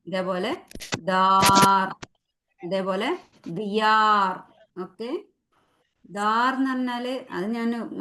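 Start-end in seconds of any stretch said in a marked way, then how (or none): 0.1-4.4 ദാർ and ദിയാർ read slowly, each stopping on a bare ർ; slow demo. Shown as a demonstration. Teacher demonstrating how words end, slowly. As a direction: slowly model ദാർ then ദിയാർ, clipping each final r. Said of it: "ഇങ്ങനെ നിർത്തുന്നു ട്ടോ".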